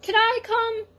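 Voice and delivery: high, eager little cat; young cat begging to join; Cat's voice. A narrator's reading. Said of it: "asked Cat".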